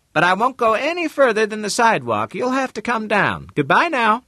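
high bright female voice; woman's voice. Acted. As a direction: lift into a high lady's voice. Mrs Lane voiced high.

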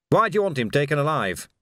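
ordinary delivery

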